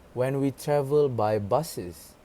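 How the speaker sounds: normal talking voice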